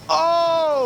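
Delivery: sudden high startled oh; surprised gasp. Real, not acted. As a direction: yelp as the car twitches. Near crash, sudden save.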